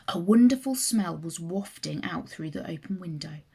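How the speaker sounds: ordinary delivery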